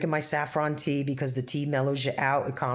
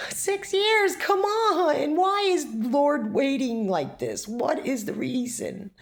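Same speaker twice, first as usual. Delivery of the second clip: anguished plea; 9 semitones higher, slower than usual. Real, not acted. Crying out to God.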